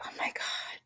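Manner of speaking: breathless whisper of shock; hushed, amazed oh my God; stunned whisper. Retold or imitated. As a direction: whisper it like you just realised. Stunned reaction retold.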